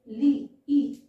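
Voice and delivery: ली, ई loud, with the ee stretched; long vowel. Shown as a demonstration. Teacher isolating the long sound for learners, loud drawn-out ली, ई. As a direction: stretch and push the ee in ली, ई. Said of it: "ई की sound बढ़िया रही है".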